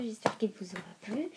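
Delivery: ordinary talk, nothing marked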